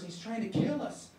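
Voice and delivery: ordinary delivery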